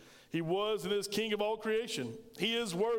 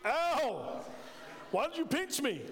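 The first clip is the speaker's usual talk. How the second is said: high-pitched mock yell of pain; imitated child's outraged yelp; 6 semitones higher, slower than usual. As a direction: cry out like a pinched, indignant kid. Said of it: "And I yelled out".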